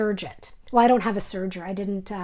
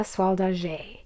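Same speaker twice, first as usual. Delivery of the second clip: a careful try at French Soie d'Alger, softer; French accent; 3 semitones lower, 7 dB quieter, slower than usual. Shown as a demonstration. Crafter showing viewers her French for the silk brand. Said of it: "My French accent is not great".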